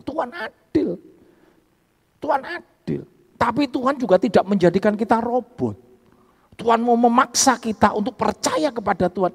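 high, loud fervent preaching. Acted. Preacher pressing the congregation, at full force.

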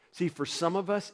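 ordinary delivery

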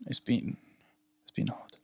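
hushed, breathy whisper; whispering on the phone. Real, not acted. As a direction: whisper it so nobody hears. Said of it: "Why are you whispering?".

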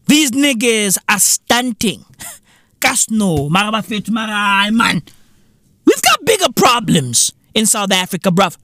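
ordinary delivery

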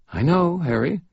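a hint of a bored apprentice; impatient Molly. A narrator's reading. Molly, young woman, impatient.

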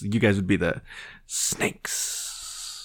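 hissing snake impression. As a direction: draw out a hiss on snakes. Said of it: "That's a really good snake impression".

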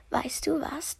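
geflüstert, lauernd; flüstere es lauernd; drohendes Flüstern. Acted. Bär flüstert vor dem Fressen. (der Bär flüstert lauernd vor dem Biss)

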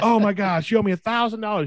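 high, whiny imitation of a panicking man; imitating the jet ski man demanding money, high and whiny; mock freaking out. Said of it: "But he's freaking out".

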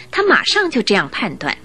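ordinary talk, nothing marked

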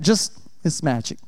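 quiet slow joke aside; low soft joking aside; hushed aside. Real, not acted. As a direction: drop it down like a secret.

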